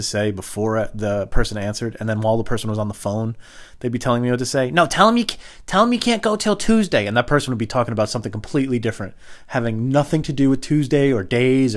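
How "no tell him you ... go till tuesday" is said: high, loud prompting; insistent parent. Retold or imitated. Parent feeds child lines mid-call, insistent high loud voice. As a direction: cut in and order the child, voice raised.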